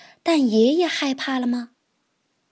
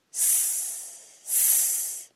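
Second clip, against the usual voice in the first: long soft unvoiced snake hiss; 14 dB quieter than usual. Snake hissing. (蛇的嘶嘶声)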